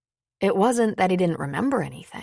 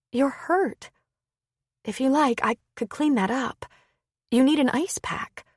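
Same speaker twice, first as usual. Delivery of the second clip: lightly voiced woman, higher, concerned; a woman pleading gently, higher pitched; worried woman; 7 semitones higher than usual. Lin Sue offers care.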